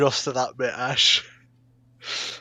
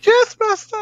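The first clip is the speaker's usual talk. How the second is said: mock servant; 11 dB louder than usual. Laughing mock servant reply.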